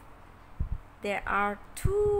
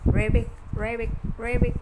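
quick croaky ribbit ribbit; frog ribbit; 5 dB louder, much faster than usual. Retold or imitated. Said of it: "How does it sound here?".

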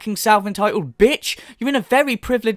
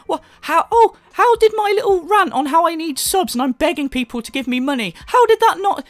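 high-pitched mock whining rant; mocking the streamer; 9 semitones higher than usual. Commentator imitates streamer begging for subs, mocking whine. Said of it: "the way she opens is like".

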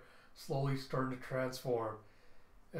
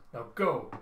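urgent command; 6 dB louder than usual. Acted. Father sends child away urgently.

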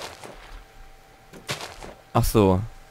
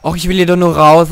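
higher, louder whining plea; loud whine; 6 semitones higher, 7 dB louder than usual. Real, not acted. Gamer stuck in the pool, loud whining plea. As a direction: whine to be let out.

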